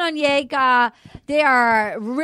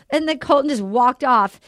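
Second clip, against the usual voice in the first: ordinary talk, nothing marked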